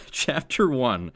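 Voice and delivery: slow, weighty chapter title; reading her childhood fanfic title aloud, slow and dramatic. Acted. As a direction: announce the title slowly for effect.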